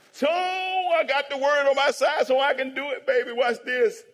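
high, loud, drawn-out boast; exuberant preaching shout. Real, not acted. Pastor hyping his proof to the church, exuberant shout. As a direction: holler it out to the congregation.

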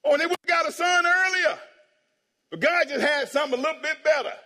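high, loud shout easing slightly; fired-up preaching. Real, not acted. Pastor driving home God's better answer, fired-up shout. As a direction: cry it out to the congregation. Preacher building to his point.

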